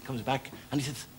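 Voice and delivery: ordinary delivery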